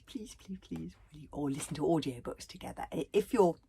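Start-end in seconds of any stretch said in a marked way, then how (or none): 0.1-0.9 put-on soft, begging child; pleading child. Shown as a demonstration. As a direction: beg sweetly. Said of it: "just say".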